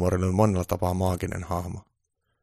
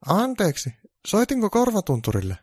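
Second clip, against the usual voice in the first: high, polite caller voice; producer's high polite question; 12 semitones higher, 4 dB louder than usual. Acted. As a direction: play a puzzled phone caller. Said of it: "Tuottaja sanoi puhelimessa".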